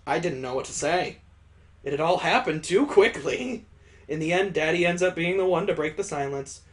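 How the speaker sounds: ordinary delivery, nothing marked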